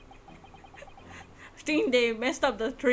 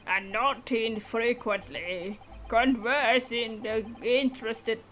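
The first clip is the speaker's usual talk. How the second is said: put-on slow, lowered old-man drawl; slow old collector; 2 semitones lower, slower than usual. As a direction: ramble slowly like an old gent.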